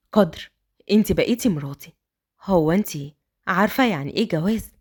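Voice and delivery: put-on a grown man talking kindly to a child; gentle man voice. A narrator's reading. Raad asks.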